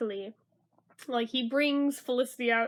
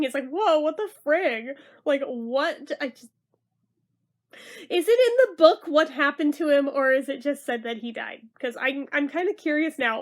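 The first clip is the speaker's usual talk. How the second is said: normal talking voice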